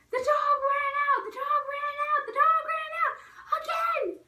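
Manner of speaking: very high, loud mock shriek; imitated alarmed yelling. Brother alerting house that the dog escaped, high mock yell. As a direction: shout the alarm like a panicking brother.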